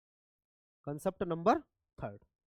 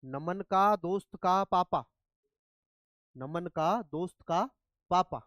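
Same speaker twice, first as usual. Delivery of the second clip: slow, word by word; slower than usual. Shown as a demonstration. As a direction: spell out each का slowly. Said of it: "अब मुझे सोच के बताना". (धीरे-धीरे, हर शब्द अलग करके)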